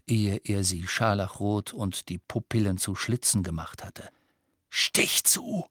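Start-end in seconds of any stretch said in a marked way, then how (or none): 4.7-5.7 urgent whisper. Acted. Harry tells Ron stab.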